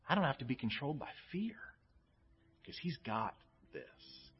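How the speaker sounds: sermon easing down to a soft close; softening. Acted. Sermon winding down softly.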